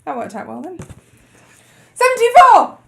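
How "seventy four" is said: loud, high cry of a score; excited announcement. Real, not acted. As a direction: call out the number loudly. Announcing a big quiz score.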